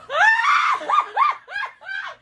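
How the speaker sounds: long high-pitched laughing shriek. Real, not acted. Mother overcome by the absurd claim, shrieking laughter.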